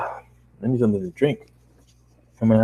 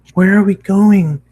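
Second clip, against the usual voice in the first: high pony voice; 10 semitones higher, 8 dB louder, slower than usual. Acted. Pony asks, first take.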